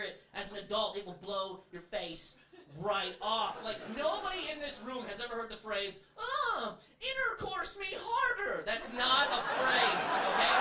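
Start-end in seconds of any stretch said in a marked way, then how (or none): 6.2-8.6 mock breathy passion. Mock bedroom phrase.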